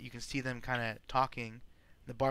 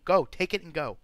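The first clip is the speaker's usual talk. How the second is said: louder, higher in-character order; barkeep shooing someone off; 5 semitones higher, 9 dB louder than usual. Acted. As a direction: send them off with a loud, impatient order. Barkeep character shooing the player away.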